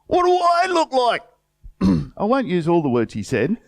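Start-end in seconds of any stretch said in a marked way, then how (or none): 0.1-1.2 much higher, scornful mimicry of a rude cook; mock indignant worker. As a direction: sneer it back at him in a high, put-on voice. Mocking the worker's rude reply.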